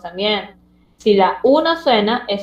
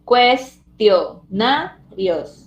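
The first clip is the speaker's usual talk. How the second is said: "Cuestionarios" very slow, cues, tio, na, rios; syllable by syllable; much slower than usual. Shown as a demonstration. Teacher giving the answer to pupils, "Cuestionarios" in separated syllables. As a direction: leave gaps between syllables of "Cuestionarios". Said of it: "Separe silábicamente la palabra cuestionarios".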